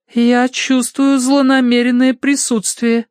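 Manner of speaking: high, slow séance voice; ominous medium. A narrator's reading. Madame senses an evil presence, ominous intoning.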